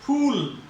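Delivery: फूल with the oo held long. Shown as a demonstration. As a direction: stretch the vowel of फूल. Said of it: "यहां पे देर तक ठहर रही है आवाज". (ऊ को देर तक खींचें)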